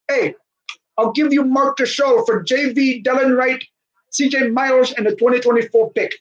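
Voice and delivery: Masai Ujiri. Retold or imitated. Team boss offering the Grizzlies a trade, mock eager. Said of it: "He's like".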